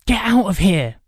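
high, loud, rough dismissal; spat angrily. A narrator's reading. Boy driving off the beaten robber, spitting angrily. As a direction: spit it out with force. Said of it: "he spat".

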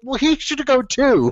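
high, slow whiny comeback; mock indignant retort. Student answering the teacher, high slow and whiny. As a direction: drag out the outraged kid retort. His own retort retold.

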